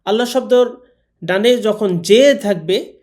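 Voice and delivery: usual speaking voice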